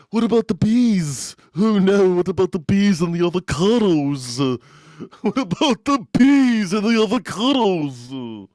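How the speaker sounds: high, loud hammed-up posh English; mock English accent. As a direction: fret like a fussy English pundit. Mocking English commentators.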